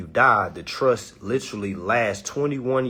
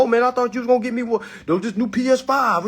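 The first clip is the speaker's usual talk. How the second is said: high, fast whiny letdown; husband expecting a PS5 gets a trust; disappointed husband; 14 semitones higher, 5 dB louder, faster than usual. Retold or imitated. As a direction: rush it out like a let-down kid. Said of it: "He gonna be looking for".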